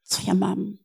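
softly imitated broken voice; understated, hushed heartbreak; breaking voice. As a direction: retell her reply quietly, voice dropping away. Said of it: "załamującym się głosem powiedziała".

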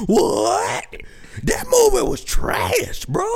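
high, drawn-out mock outrage; mock outraged moviegoer. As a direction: wail the verdict in a put-on voice. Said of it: "that's what everybody else is doing".

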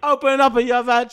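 leer it like a comic Italian; hammed-up Italian accent. Mock Italian character bit.

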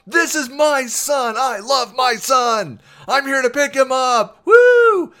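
high, loud, slow proud-dad holler; shouting. Retold or imitated. Dad showing off his son to kids, loud whooping shout. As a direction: shout it to every kid around, ending in a whoop. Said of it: "still honking and just shouting".

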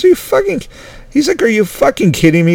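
the doctor can't believe it, pitch jumps up; mock-outraged doctor; disbelieving doctor. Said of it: "I'm just gonna talk like I was the doctor".

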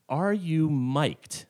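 Martha asks him very slowly, drawn out; slow deliberate question. Retold or imitated. Martha to the guide, slow and pointed. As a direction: stretch each word, deadpan. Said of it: "Are you wearing a microphone?".

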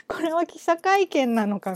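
a hint of an irritated official brushing off a reporter; 不機嫌な老政治家の口調を軽く真似て; mock indignant old politician. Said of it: "有無も言わさない喋り方".

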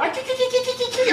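very high squeal imitation; high squeaky mock voice. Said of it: "in the video talking about".

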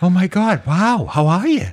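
high-pitched celebrity impression; Mark Wahlberg. Impersonating the star with the shaved head, high-pitched. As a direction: pitch it up like an excited movie star. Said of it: "did you see Mark Wahlberg's new do".

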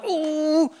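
a high, wincing ooh; mock pained ooh at the button. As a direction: squeal it like it hurts. Reacting to pressing tithe.

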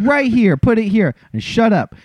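high, impatient mock command; mock impatient order. Nurse telling the husband to shut up, mock impatient. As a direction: shoo him into place.

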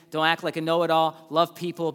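ordinary talk

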